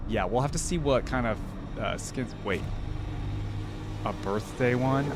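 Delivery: ordinary talk, nothing marked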